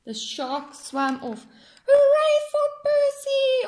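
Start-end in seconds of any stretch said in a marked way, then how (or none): 1.9-3.7 high, loud sung cheer; crowd cheering. Acted. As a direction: cheer the hero together. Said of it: "all the fishes cried".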